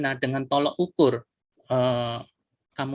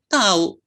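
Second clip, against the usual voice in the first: "Tahu" pitched higher and louder than the rest, so it means "I don't know"; raised intonation; 5 semitones higher, 7 dB louder than usual. Shown as a demonstration. Answering where the headmaster is, with a raised sarcastic "Tahu". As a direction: say "Tahu" high and loud, as a dismissive answer. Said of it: "dengan intonasi yang dinaikkan".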